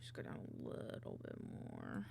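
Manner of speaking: soft unvoiced whisper. Real, not acted. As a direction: mutter it as a whisper. Whispers to herself while editing.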